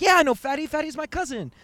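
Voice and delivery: high, animated mock reply; acted eager reply. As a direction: imitate a pal chiming right in. Buddy answers stranger eagerly.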